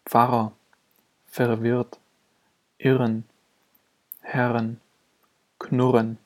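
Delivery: don't lengthen the RR in "Pfarrer", say one consonantal R; single consonantal R. Shown as a demonstration. Teacher showing that double R sounds like single, consonantal R. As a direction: double R in "irren", "Herren", "knurren" said as one consonant R. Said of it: "Auch Doppel-R unterscheidet sich in der Aussprache nicht".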